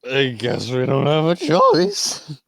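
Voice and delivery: loud, slow, drawn-out character voice; Mage Hand. Acted. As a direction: stretch it out, rising in pitch and volume. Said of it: "Mage Hand's like".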